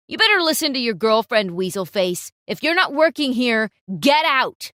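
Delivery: loud, quick sneering threat; taunting photographer. Acted. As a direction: snap at him to get out.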